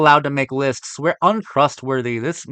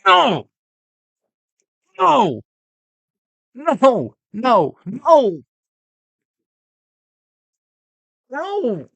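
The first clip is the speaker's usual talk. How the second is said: loud, high, anguished no, over and over; repeated shouts of no; 10 semitones higher, 4 dB louder, much slower than usual. Real, not acted. Reacting to the ranking with high, loud shouts. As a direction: howl no at the screen in disbelief.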